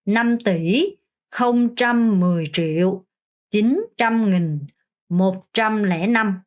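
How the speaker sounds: very slow, chunk by chunk; teacher's slow chunked count; slow demo reading. Shown as a demonstration. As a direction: lay out each chunk slowly. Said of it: "다시 한번 해보겠습니다".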